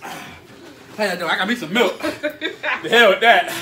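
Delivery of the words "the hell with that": laughing. Real, not acted. Laughing in spicy pain.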